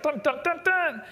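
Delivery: sung high dun-dun-dun like a film reveal; dramatic sting. Pretend. Preacher mock-sings a suspense sting at the posters. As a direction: hum a mock suspense chord.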